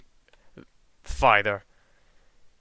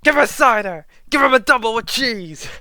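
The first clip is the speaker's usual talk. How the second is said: loud, high ringside announcer; hyped-up announcer yelling over a crowd; fight announcer; 10 semitones higher, 8 dB louder than usual. Acted. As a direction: shout the fight on like an excited commentator. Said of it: "And the announcer's just going".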